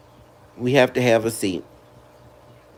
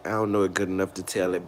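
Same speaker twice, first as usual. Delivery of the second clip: much softer, lower, quick aside to herself; muttered repeat; 8 semitones lower, 10 dB quieter, faster than usual. Real, not acted. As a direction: mutter it again under your breath.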